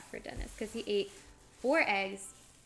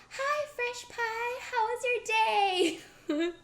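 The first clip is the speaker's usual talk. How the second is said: mom's greeting; 10 semitones higher, 6 dB louder than usual. Retold or imitated. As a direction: imitate mom sweetly greeting her kids. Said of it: "she'd be like".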